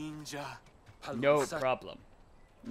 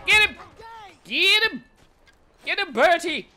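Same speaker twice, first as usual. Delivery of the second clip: high, excited attack command; urging on; 8 semitones higher than usual. Real, not acted. Player sending Bertie after an enemy, excited urging. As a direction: egg the animal on, pitched high. Urging on pet badger in game.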